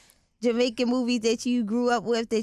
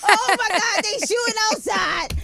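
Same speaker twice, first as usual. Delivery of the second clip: high loud frantic cry; panicked yelling; 10 semitones higher, 5 dB louder than usual. Retold or imitated. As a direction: scream in mock panic. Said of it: "I said".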